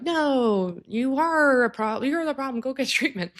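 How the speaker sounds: high, snippy comeback with a laugh; mock retort. Mocking a polarizing reply to someone in a rage, high and snippy.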